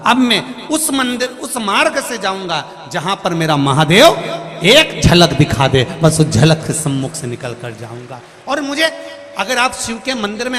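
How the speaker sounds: loud, rising sermon voice; fervent preaching. Acted. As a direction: preach it with full force to the crowd. Preaching at full force.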